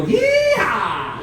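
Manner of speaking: high whooping cowboy yell; yeehaw shout. Shown as a demonstration. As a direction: whoop it out for the crowd. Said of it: "I need every single one of you to go".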